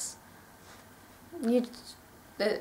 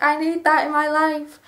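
excited want; 4 semitones higher, 3 dB louder than usual. Retold or imitated. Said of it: "I was like".

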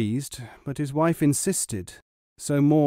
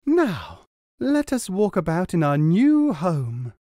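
proud wife; 6 semitones higher, 4 dB louder than usual. A narrator's reading.

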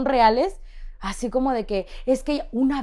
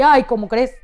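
a much louder ay of disbelief; no way, said loudly; stunned by the fee; 3 semitones higher, 10 dB louder than usual. Retold or imitated.

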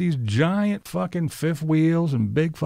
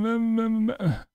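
mock babble; 7 semitones higher than usual. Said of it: "mocking babble".

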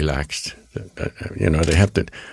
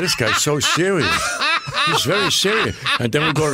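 high, laughing disbelief; 7 semitones higher than usual. Real, not acted. Telling the interviewers about his serious co-star, laughing high. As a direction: laugh the words out.

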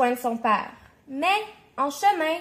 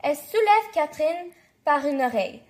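ordinary delivery

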